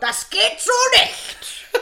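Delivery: high, slow, loud reprimand; hammed-up accent-police shout; mock police command. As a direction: forbid it with comic outrage. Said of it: "war die Akzente-Polizei".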